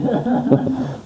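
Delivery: quick breathy laugh at the word; soft laugh. Real, not acted. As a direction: let out a short amused laugh.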